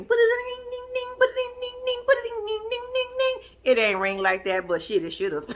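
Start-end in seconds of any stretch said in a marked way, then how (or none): 0.0-3.5 high, steady sing-song ringtone; mouth-made ringtone, high and repetitive; imitated phone ringing. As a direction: mimic a ringing phone on one high note. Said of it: "the phone ring".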